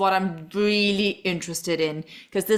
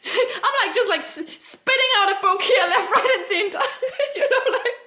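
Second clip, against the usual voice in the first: talking through giggles, much higher; high, giggly, laughing through the words; 10 semitones higher than usual. Real, not acted. Laughs about her book writing.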